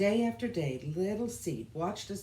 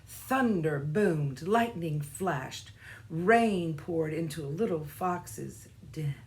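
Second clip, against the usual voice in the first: usual speaking voice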